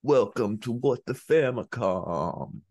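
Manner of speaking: very slow, drawn-out intro in a mock old Boston accent; put-on accent. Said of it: "say it with that accent".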